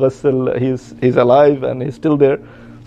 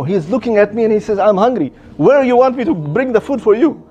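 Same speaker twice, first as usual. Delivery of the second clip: high-pitched, incredulous complaint; acted exasperation; 7 semitones higher than usual. Egyptian brother recounting the hungry dead man, exasperated. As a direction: protest in a raised pitch at an absurd demand.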